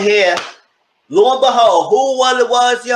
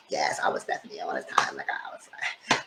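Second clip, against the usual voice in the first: low hushed confession; a soft guilty whisper; 13 semitones lower, 12 dB quieter than usual. Retold or imitated.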